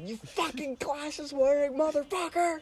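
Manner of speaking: high, slow, laughing yell; drunken screaming with laughter. Retold or imitated. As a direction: yell the insults half-laughing. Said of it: "And I said".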